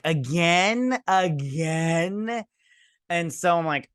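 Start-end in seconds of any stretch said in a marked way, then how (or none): 0.0-2.4 exasperated. Retold or imitated. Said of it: "And I'm all like".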